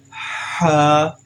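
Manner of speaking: Ha slightly stretched from the throat. Shown as a demonstration. Teacher showing students the stretch: slightly drawn-out Ha. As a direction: hold Ha a little, breathy. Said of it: "like this".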